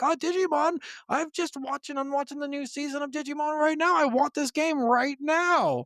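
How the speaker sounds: high, gushing fanboy squeal; excited kid voice. Retold or imitated. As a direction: gush like a kid spotting the game on the shelf. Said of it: "being like".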